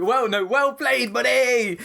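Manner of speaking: high, drawn-out mock congratulations; high-pitched jeering call; audible taunting call. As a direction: imitate a sarcastic player's send-off, high and slow. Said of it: "you can hear it audibly going".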